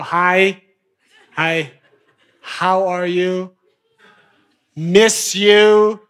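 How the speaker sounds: drawn-out, lingering hello; a coaxing, slow hello; slow flirty greeting. Acted. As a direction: stretch the greeting out like a tease.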